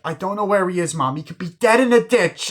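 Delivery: high, loud fretting impression; mock worried wife. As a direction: imitate a wife working herself into a panic. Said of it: "she's getting all worried".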